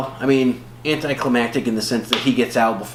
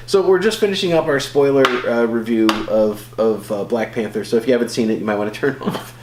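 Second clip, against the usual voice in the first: normal talking voice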